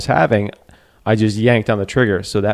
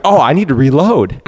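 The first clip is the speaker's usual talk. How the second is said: higher, louder, exaggerated dawning; mock sudden realization; 5 semitones higher, 5 dB louder than usual. Rattled hunter realizes he must reload, hammed-up surprise.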